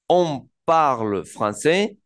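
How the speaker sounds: "On parle français" estirado y claro; francés muy lento. Shown as a demonstration. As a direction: alarga cada palabra. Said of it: "decimos, por ejemplo". (profesor pronuncia despacio el ejemplo)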